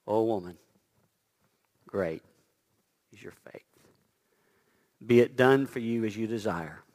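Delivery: Jesus tenderly answering the woman; tender blessing. Acted. Jesus gently commends her.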